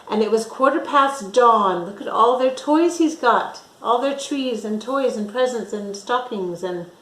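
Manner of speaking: plain reading voice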